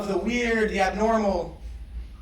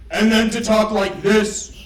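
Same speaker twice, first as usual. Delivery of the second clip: louder, slow booming delivery; 6 dB louder, slower than usual. Shown as a demonstration. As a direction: demo a big loud voice.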